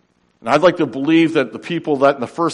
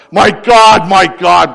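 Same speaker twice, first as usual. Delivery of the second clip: roaring cry; 6 semitones higher, 12 dB louder, slower than usual. Acted. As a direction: roar it out like Aslan. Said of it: "it cries out in a roar".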